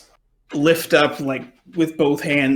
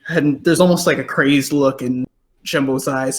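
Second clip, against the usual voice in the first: ordinary talk, nothing marked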